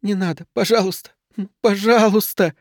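high, plaintive begging, lightly acted crying; tearful pleading. A narrator's reading. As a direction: plead through tears, pitched well up. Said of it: "плакала она". (умоляюще, сквозь слёзы)

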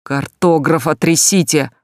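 loud, slow yell into the phone. Acted. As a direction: bark orders down the line. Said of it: "орал в трубку". (крик в трубку)

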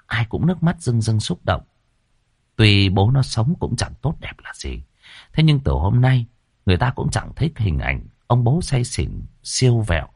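ordinary delivery

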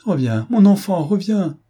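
high, loud call after a son leaving. A narrator's reading. Mother calls her son back down the corridor, loud pleading cry. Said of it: "La mère appela".

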